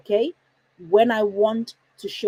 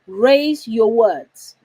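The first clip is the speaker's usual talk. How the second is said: "raise your words" stretched out and forceful; emphatic; 8 dB louder, much slower than usual. Shown as a demonstration. Emphasizing the son's motto.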